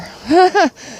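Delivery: short high-pitched laugh. Real, not acted. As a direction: let out a brief, high giggle. Laughs while talking about sharing.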